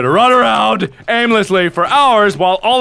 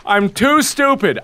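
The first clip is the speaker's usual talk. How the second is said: ordinary talk, nothing marked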